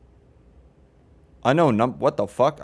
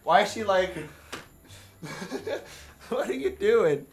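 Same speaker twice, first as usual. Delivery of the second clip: laughing; 6 semitones higher, 5 dB quieter than usual. Real, not acted. Laughing at odd walking character.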